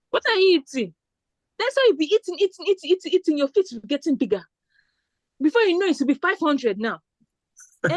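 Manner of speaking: high Nigerian auntie voice, teasing and scolding; Nigerian accent. Retold or imitated. Shoe seller teasing the girl about her feet, in a high Nigerian accent. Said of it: "I was saying it in Nigerian accent".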